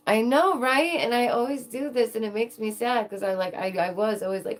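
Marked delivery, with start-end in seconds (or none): 0.1-2.6 high, loud excited burst. Real, not acted. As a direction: blurt it out thrilled.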